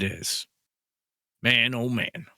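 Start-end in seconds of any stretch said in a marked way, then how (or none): none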